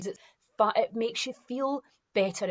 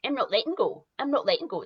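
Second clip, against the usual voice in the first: stubborn ego; 4 semitones higher, 4 dB louder, faster than usual. Retold or imitated. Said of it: "the ego kicks in. It's like".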